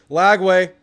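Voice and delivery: sharp loud shout of the name; loud call. Real, not acted. Reacting to a play.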